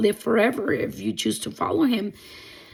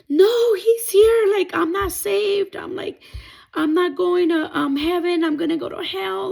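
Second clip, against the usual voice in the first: retold terrified cry; 9 semitones higher, 3 dB louder than usual. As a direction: relive the moment of dread. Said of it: "started screaming. I started crying".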